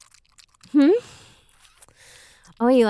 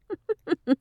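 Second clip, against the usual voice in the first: high, quick giggle; amused giggle; 8 semitones higher than usual. Acted. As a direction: let a light giggle slip out. Amused while hushing.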